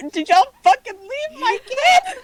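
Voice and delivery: high slow outraged cry; mock outraged shriek. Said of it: "I was like".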